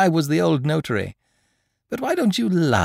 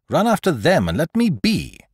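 firm order with a higher pitch; cold voice; 8 semitones higher, 5 dB louder than usual. A narrator's reading. Lupin orders his pursuer off, cold insistence. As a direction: dismiss him firmly. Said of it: "in a cold voice".

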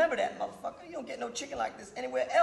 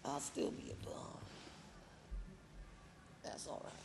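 resigned whisper; 9 semitones lower, 14 dB quieter than usual. Acted. Resigned, drifting off.

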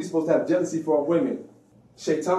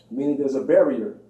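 ordinary talk, nothing marked

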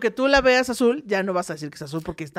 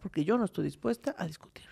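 whisper; 9 dB quieter than usual. Retold or imitated. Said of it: "bajes la voz".

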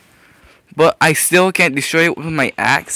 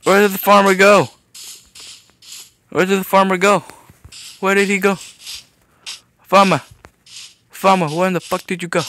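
calling out; 6 semitones higher than usual. Real, not acted. Calling for missing farmer.

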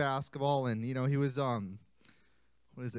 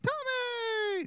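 high excited call of Tommy; shouted name. Shown as a demonstration. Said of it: "Just like that".

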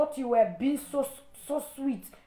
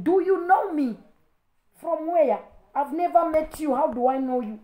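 higher, louder confrontational questioning; indignant challenge; 5 semitones higher, 4 dB louder than usual. Real, not acted. Challenging a stranger over a comment on accent, indignant. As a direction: fire indignant questions at them.

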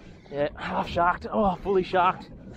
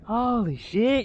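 ordinary delivery

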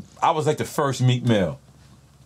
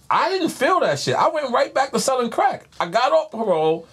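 worked-up rant; 12 semitones higher than usual. Real, not acted. Exasperated memory of album flop.

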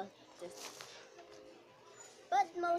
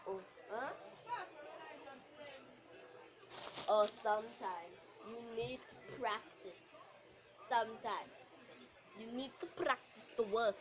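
lower, dull teacher voice; boring teacher; 4 semitones lower, 4 dB quieter than usual. Acted. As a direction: drone at the class like a bored teacher. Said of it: "The boring teacher".

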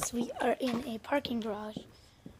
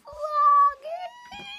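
sudden very high, louder word; high squeak; 14 semitones higher, 5 dB louder than usual. Pretend. Vlogger squeaking his opener, very high. Sing-song opening line.

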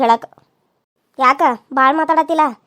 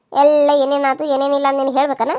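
usual speaking voice

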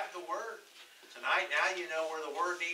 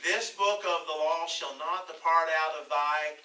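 slow, loud Bible reading; preacher reading out scripture; 6 dB louder than usual. Acted. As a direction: declaim the verse slowly to the congregation. Reads scripture aloud.

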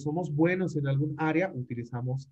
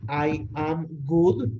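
slow model sentence; 3 dB louder, much slower than usual. Shown as a demonstration. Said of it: "teacher modeling the sentence".

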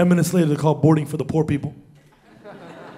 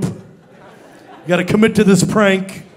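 ordinary delivery

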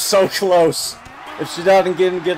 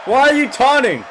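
loud, much higher, baffled yell; exasperated shout; 10 semitones higher, 6 dB louder than usual. Real, not acted. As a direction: yell the question in disbelief. Exasperated at wrestler taunting.

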